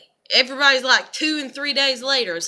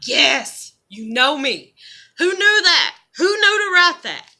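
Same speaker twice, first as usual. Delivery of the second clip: sarcastic cheer; 3 semitones higher, 6 dB louder than usual. Retold or imitated. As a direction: cheer the meme like it read your mind. Mock-cheering a meme that knows her.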